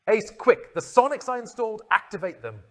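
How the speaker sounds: high, loud urgent command; urgent instruction. Acted. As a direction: bark it out in a hurry. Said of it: "the Doctor instructed".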